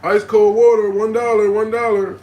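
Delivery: loud hawker's cry, selling water on the corner; street vendor call. Retold or imitated. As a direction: belt it out like a corner water seller. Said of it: "Just like this".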